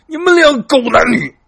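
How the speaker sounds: high, loud, slow bellow of rage; a husband catches his wife and bellows at them through the door, enraged; furious shout. Retold or imitated. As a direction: roar it at the cheating pair. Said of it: "在那喊一声".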